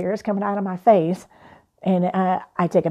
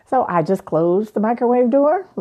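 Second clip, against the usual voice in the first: ordinary talk, nothing marked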